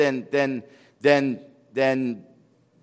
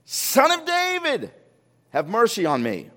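imitated yell; 6 semitones higher than usual. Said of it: "He yells out even louder".

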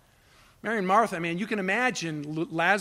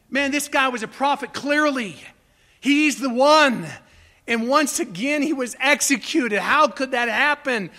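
louder and higher, pleading with heaven; anguished protest; 6 semitones higher, 7 dB louder than usual. Retold or imitated. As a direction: cry out why God failed them. Preacher acts out the disciples' despair.

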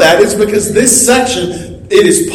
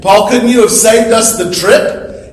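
normal talking voice

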